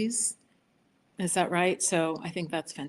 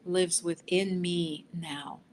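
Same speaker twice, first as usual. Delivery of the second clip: slow affirmation; slower than usual. Shown as a demonstration. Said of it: "you just wanna listen the first time".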